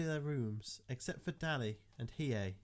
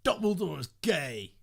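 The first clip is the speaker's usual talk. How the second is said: loud, sudden blurt, pitched up; 5 semitones higher, 10 dB louder than usual. Acted. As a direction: blurt it at full volume. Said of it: "blurted Edward".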